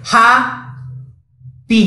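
ହାପି very slowly, with a long silence between "haa" and "pi"; ହାପି as two far-apart syllables; exaggerated syllable split. Shown as a demonstration. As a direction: hold a big gap inside ହାପି before the "pi". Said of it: "ମଝିରେ ବନ୍ଦ ହେଲା".

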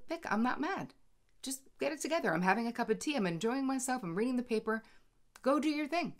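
airy unbothered tone, as if sipping tea; breezy reassurance. Retold or imitated.

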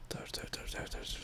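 soft unvoiced whisper. Real, not acted. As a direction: whisper so as not to be found. Hiding quietly in game.

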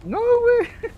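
squealed, very high objection; high-pitched protest. Real, not acted. Player hurt by teammate, high-pitched protest. Pleads as teammate hurts him.

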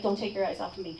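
low, soft imitation of an instructor; no-nonsense instructor. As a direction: lightly voice a quiet, controlled order. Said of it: "just pure leadership. No nonsense".

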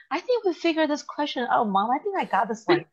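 put-on children calling out their answer through the door; proud child voice. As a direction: kids proudly telling mom they solved it. Kids proudly report solving problem.

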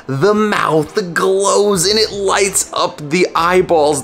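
hyped showing off the glowing statue to viewers, excited; excited reveal. Real, not acted. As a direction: savour the big reveal slowly, rising with wonder. Statue lights up, final reveal.

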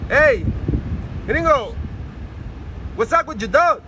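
calling out to the gringo across the fence; shouting. Real, not acted. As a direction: yell at him from afar. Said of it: "Ahí le voy a gritar".

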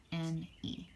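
whispered last letters. Shown as a demonstration. Teacher whispering the end of plane.

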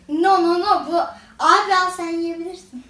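lightly voiced talking vinegar; playful mock pleading voice. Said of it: "bak, diyor ki". (sirkeyi canlandıran şakacı ses)

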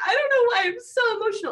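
high, loud, laughing through the words; retelling her own tearful reaction while laughing; laughing, emotional. Retold or imitated. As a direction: giggle it out, overwhelmed by a sad movie. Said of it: "And I was like".

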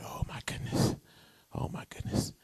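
breathless unvoiced whisper; shocked whisper. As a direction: gasp it under your breath in shock. Reacting to bad text.

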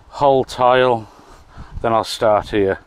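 normal talking voice

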